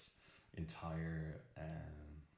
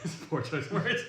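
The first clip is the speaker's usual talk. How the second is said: words breaking up in laughter; giggling at an awkward phrase; laughing; 14 semitones higher, 9 dB louder than usual. Real, not acted. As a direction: laugh through the line, amused at yourself. Laughing at own word choice.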